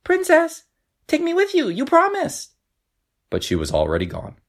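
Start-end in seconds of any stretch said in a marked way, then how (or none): 0.0-2.5 high frog voice calling after. A narrator's reading. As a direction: call after her, little frog. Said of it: "the frog called after her".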